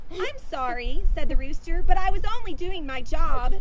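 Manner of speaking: loud, proud declaration; proud rooster. Acted. Rooster defending his crow to the animals, loud and proud. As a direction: announce it with pride. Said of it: "with a great deal of pride".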